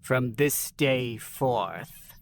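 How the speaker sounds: slow, measured proclamation; slowly. Acted. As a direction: lay out each word slowly. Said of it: "Ilara continued slowly".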